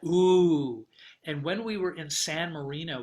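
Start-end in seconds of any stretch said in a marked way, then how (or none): 0.1-0.9 loud, drawn-out imitation ooh; sustained mock ooh; imitated ooh. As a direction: mimic them with a long, loud ooh. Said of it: "they did that".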